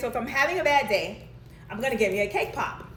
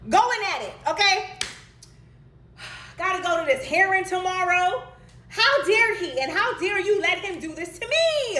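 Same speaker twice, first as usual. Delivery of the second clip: high, loud, exasperated pleading; venting hard at God, voice pushed up; heated rant at God; 9 semitones higher, 3 dB louder than usual. Retold or imitated.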